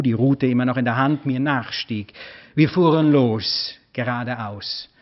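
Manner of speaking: ordinary delivery, nothing marked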